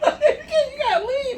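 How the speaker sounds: high, slow, drawn-out imitation with laughter; laughing send-up of being kicked out; mock dad's dismissal. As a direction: draw out the order in a high mock voice. Retold dad sending guest away.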